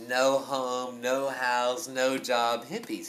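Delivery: ordinary talk, nothing marked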